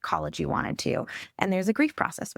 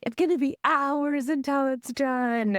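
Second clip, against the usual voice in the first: imitating the child; 7 semitones higher, slower than usual. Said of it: "she was like".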